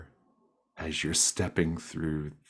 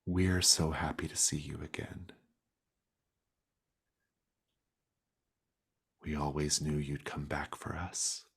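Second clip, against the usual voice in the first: whispery creature voice. Acted. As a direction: whisper it like an eerie sweet creature. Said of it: "one that's talking to you".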